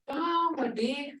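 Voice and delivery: high, slow, rising "Don, what did?"; grandma asking the granddaughter what happened; concerned tone. Retold or imitated. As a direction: ask slowly with a worried rise. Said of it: "hear that tone?".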